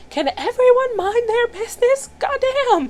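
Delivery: Tommy shouting at the whole bowling alley, fed up; exasperated yell. Retold or imitated. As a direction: yell at the nosy crowd. Tommy snaps at bowling kids.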